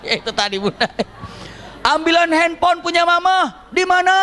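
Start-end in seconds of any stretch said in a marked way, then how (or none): 1.8-4.1 high, stretched-out calling voice; mock mother-and-child shouting exchange; yelling across house. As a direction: shout it from room to room.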